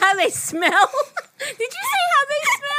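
high laughing squeal of disbelief. Real, not acted. Laughing incredulous at her friend's slip. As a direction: crack up at the silly mishearing.

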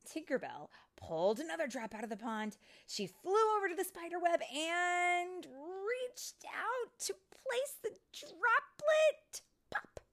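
rising high and loud, stretching out the words; building suspense. A narrator's reading. Storyteller building to droplet bursting, suspenseful.

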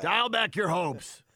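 mock yelling. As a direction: yell it like a blaring injury report. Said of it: "like they're yelling at you".

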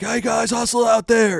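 high, loud, drawn-out sideline holler; mimicked coach bellowing encouragement. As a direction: yell it out to the whole team like a coach. Mimics a coach's generic sideline call.

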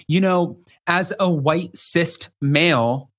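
slow, drawn-out impression of a man; impersonating him. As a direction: pompously stretch each word of an imitation. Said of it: "to impersonations".

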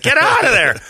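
high, loud mock yell; screaming at TV. Viewer warning the victim on TV, high mock scream. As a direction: yell it like a heckler in a movie theater. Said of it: "I'm screaming at the T V".

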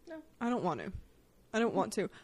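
usual speaking voice

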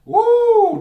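a loud, high whoop of delight; excited whoop. Real, not acted. Thrilled reader whooping at the jumping card, loud and high. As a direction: cheer as a card leaps out. Cards jump out, exclaims.

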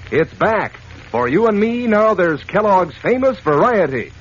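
usual speaking voice

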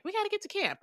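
high mock urgency. Characters rushing to camp.

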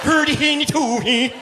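high sing-song Swedish Chef gibberish; high hammed-up Muppet chef singsong. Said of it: "the Swedish chef from the Muppets".

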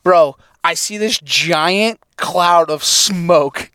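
usual speaking voice

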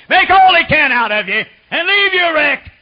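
high, loud fiery preacher's cry; preaching at full force. Acted. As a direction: thunder it out to the congregation. Preaching climax.

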